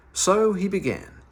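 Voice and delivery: usual speaking voice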